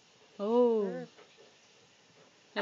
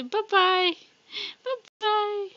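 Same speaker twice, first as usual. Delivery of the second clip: put-on high, louder singsong bye-bye to baby; 9 semitones higher, 5 dB louder, much slower than usual. Shown as a demonstration. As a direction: sing it up high for the little one. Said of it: "say bye-bye".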